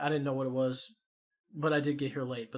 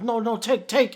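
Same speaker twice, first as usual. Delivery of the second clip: panicked pleading; 6 semitones higher, 6 dB louder than usual. Retold or imitated. Vendor panics, thinks he's robbed.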